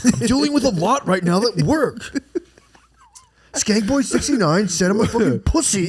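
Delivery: high, whiny, overwrought dad; stressed whiny dad. Pretend. Dad blaming comment trolls to his family, high and whiny. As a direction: whine about internet insults, way up high.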